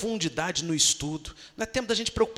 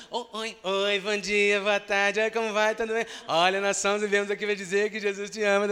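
rushed, put-on cheerful door-to-door voice; hammed-up salesy welcome; preacher mocking a hollow doorstep greeting; mock cheery greeting; 3 semitones higher than usual.

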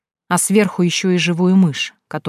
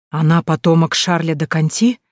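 usual speaking voice

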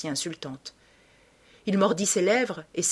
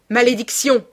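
loud, high outcry; explosive cry, pitched up; cursing shout; 6 semitones higher, 9 dB louder than usual. A narrator's reading. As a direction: shout it as a curse. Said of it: "cria-t-il".

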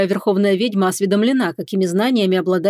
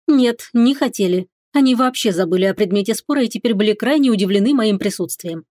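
usual speaking voice

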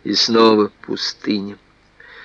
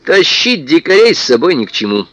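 usual speaking voice

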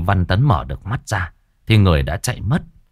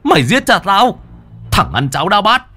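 furious shout; 6 semitones higher than usual. Acted. Son beating his father's killer, furious high shout. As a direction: scream rage at him while striking.

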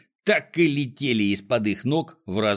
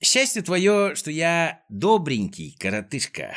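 higher-pitched, lightly put-on Klops character voice; condescending, pitched-up character line; mock-kindly villain; 6 semitones higher than usual. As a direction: play a captor pretending to be kind. Klops gloats over captured Neznaika.

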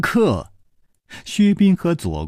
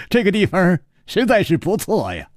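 higher, amused and teasing; laughing; 8 semitones higher than usual. Acted. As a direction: tease them while still laughing. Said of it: "楚留香笑着说". (楚留香调侃薛公子，含笑说)

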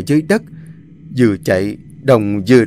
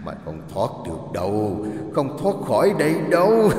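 eerie demon voice, softer, rising at the end; demonic double voice; 3 semitones higher, 4 dB quieter than usual. Acted. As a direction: taunt like a demon hunting prey. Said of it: "tiếng nói kinh dị của con quỷ".

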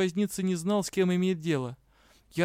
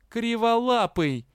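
louder, much higher, slower retort; retort insult; 7 semitones higher, 5 dB louder, slower than usual. A narrator's reading. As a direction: fling the insult back. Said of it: "не остался в долгу партнёр". (ответный выкрик)

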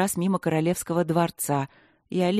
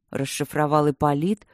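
ordinary delivery

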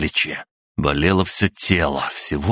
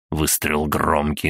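ordinary delivery, nothing marked

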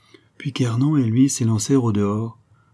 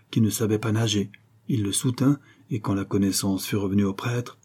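ordinary delivery

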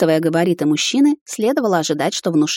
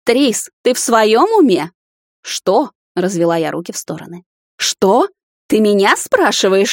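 гневная отповедь; 3 semitones higher, 4 dB louder than usual. A narrator's reading. Мать гневно отчитывает дочь.

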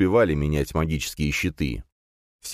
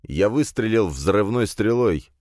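ordinary delivery, nothing marked

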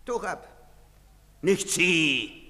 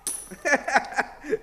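scornful laugh; 7 semitones higher than usual. Acted. Soldier laughing at money.

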